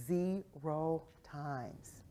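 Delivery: "zero times" very slow and soft; slow, deliberate emphasis. Real, not acted. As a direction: space out "ze-ro times" word by word. Slow emphatic repeat of the answer.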